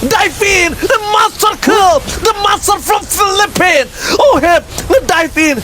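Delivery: high, shouted player intro; hype shout. Acted. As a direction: announce the star player. Live esports play-by-play.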